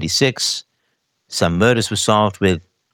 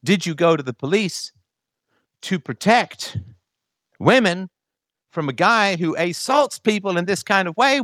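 imitating an offended woman, pitched up; a hammed-up how-dare-you; mock angry retort; 7 semitones higher than usual. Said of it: "when people just get angry".

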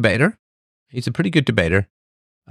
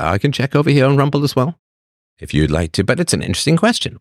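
ordinary delivery

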